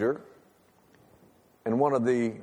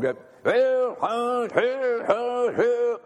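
drill corporal cadence; 9 semitones higher, much slower than usual. Retold or imitated. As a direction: bark the marching count like a drill instructor. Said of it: "He had a lisp".

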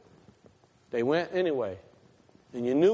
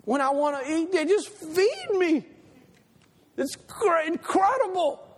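put-on baby's voice; 10 semitones higher than usual. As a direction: coo it like a delighted toddler. Voicing the baby's thoughts.

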